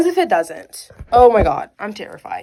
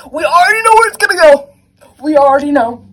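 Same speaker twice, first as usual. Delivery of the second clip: loud, high outburst; exasperated shout; 6 semitones higher, 11 dB louder, faster than usual. Real, not acted. Reader dreading where the chapter goes, exasperated shout.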